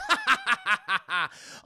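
high villain laugh; evil cackle. Retold or imitated. Villain squeezing his victim.

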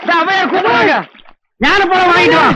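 ordinary talk, nothing marked

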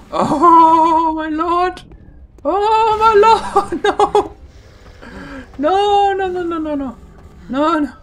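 very high, loud, drawn-out cries of dread; panicked wailing. Real, not acted. Player attacked by the giant worm, panicked wailing. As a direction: wail in real panic.

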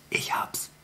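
secretive whisper. Acted. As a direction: breathe it like a secret plan. Fox's sudden idea.

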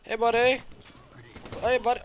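loud, high sing-out hello; mock-friendly greeting. Gamer hails an enemy who won't be friendly, high mock-friendly call. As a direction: call out high to a new pal. Hailing another player in game.

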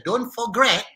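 "forget" deliberately said as "for-gret"; "forget" rhyming with "regret"; joke mispronunciation. Pretend. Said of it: "forget tu lah satu perkataan yang kita".

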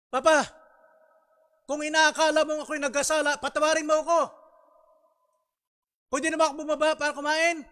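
put-on high, loud pleading child voice; a child calling down from upstairs, begging forgiveness. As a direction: beg like a child sent to his room. Child pleads with father in story.

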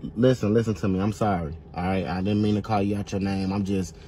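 sheepish apology. Acted. Apologizing after insult.

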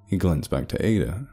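plain reading voice

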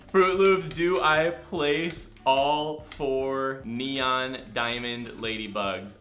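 very slow, word by word; drawn-out question. Acted. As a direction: put the question to the audience slowly. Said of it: "I'm gonna be like".

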